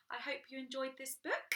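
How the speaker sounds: plain reading voice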